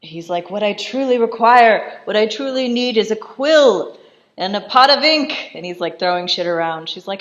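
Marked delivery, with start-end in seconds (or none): 0.5-5.5 loud, raised theatrical demand; hammed-up indignant insistence on each need; mock outraged demand. Marquis demanding quill and ink from wife, mock outraged.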